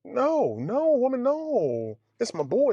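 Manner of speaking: high, pleading no woman; panicked patient begging the nurse, high and pleading; mock panicked protest. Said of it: "She's like".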